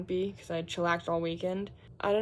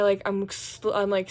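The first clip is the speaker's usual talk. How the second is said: ordinary talk, nothing marked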